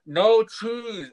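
loud, high, drawn-out call; calling out an order. Retold or imitated. Customer to counter staff through screen and mask, calling out.

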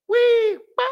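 very high, loud cartoon whoosh; sudden high-pitched playful noise; playful sound effect. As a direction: squeal it like a bouncing toy. Moon bouncing back.

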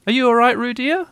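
high, sing-out motherly question; lilting mum checking on baby; fussing mother kangaroo. A narrator's reading. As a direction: pitch it up for a little one.

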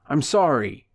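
ordinary delivery

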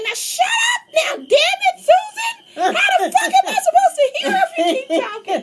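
high, loud mock yell; cussing old husband. Mimicking a husband yelling at his wife on the phone, high and loud. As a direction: snap at your wife like a fed-up old man. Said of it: "He'll be like".

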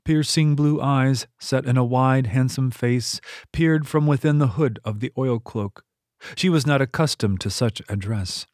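plain reading voice

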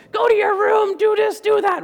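high-pitched shouted scolding; mom yelling. Acted. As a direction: yell it like a nagging mom. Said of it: "when I say he was yelling at me, it wasn't like".

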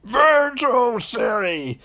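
high, drawn-out villain cry; slow, over-the-top proclamation; costumed villain. Retold or imitated. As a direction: announce it like a hammy masked villain. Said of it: "This guy walks out in a Gimp costume".

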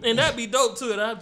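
high, loud and excited. Real, not acted. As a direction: hype it up. Excited after laughing.

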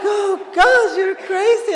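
high, loud amused outburst; mock exclamation. Reacting to her singing in the car, high mock exclamation. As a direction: imitate a scandalized reaction, loudly.

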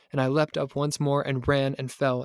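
ordinary delivery, nothing marked

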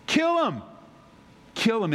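high-pitched outburst, falling hard on the end; acted cry of indignation; outraged verdict. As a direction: pronounce the sentence in mock outrage. Re-enacting David's outraged verdict.

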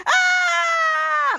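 sudden high shriek of fright; startled scream. Retold or imitated. Jasper caught by Piper, startled high shriek.

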